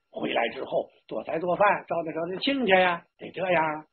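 usual speaking voice

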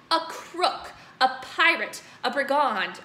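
loud, angry shouted insults; furious headmistress. Acted. Headmistress shouting insults at Bruce. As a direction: hurl each name. Trunchbull continues accusing.

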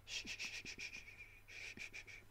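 shushing. Acted. Hushing a panicking friend.

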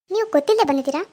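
ordinary talk

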